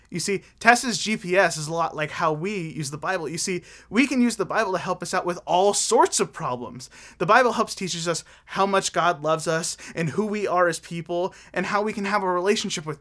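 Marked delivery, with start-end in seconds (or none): none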